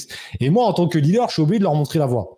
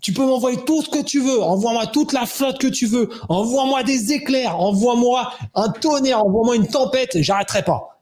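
defiant mock yell, much higher; yelling at the sky; 8 semitones higher than usual. Runner alone in the rain, yelling at the sky in defiance. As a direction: shout defiance up at the storm. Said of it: "je commence à gueuler au ciel".

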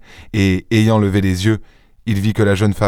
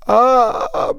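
high, drawn-out frightened "ah"; terrified gasp. A narrator's reading. Young wife struck by a terrifying idea, frightened cry. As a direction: let out a high cry of dread. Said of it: "balbutia-t-elle, étreinte par une idée qui la terrifia".

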